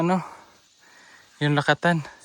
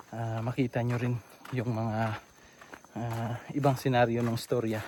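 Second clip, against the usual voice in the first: vlog host narration; 5 semitones lower, 12 dB quieter than usual. Acted. Addresses viewers like a host.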